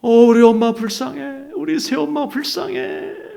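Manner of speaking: loud, high, drawn-out lament; re-enacted bawling in pity; pitying wail. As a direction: wail it slowly on a held high note. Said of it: "불쌍하다는 생각으로 바뀌는".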